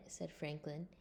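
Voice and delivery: ordinary delivery, nothing marked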